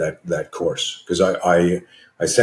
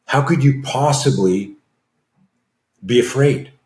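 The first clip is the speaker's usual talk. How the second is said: higher, emphatic disbelief; retold incredulity; 5 semitones higher than usual. Wife challenges fear expert about his nerves, incredulous. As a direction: press the question with a pause before the end.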